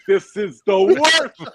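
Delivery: loud, slow, laughing groan of protest; mock outrage. Dealer griping to friends about the craps line, loud and drawn out. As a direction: moan it out like it's the worst thing ever.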